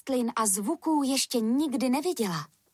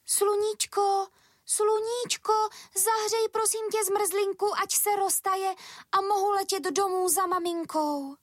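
pleading little bee; 11 semitones higher than usual. A narrator's reading. Stuck bee begs the sun to melt the ice cream, high pleading voice. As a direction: call out for help in a squeaky little character voice. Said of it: "Volala proto na sluníčko".

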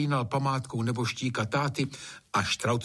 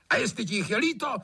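fast, high-pitched angry master; heated, quick outburst at a servant; irritated order; 12 semitones higher than usual. A narrator's reading. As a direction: fire off an impatient command.

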